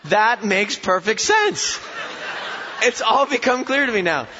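laughing, high mock realization; sarcastic amused mock epiphany. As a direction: pretend it all makes sense, laughing. Sarcasm about the Australian gecko.

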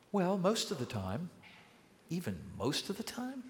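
soft, hesitant "most of the time" backing down; sheepish retreat. Acted. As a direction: concede it quietly, a little embarrassed. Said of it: "backs down on his claim".

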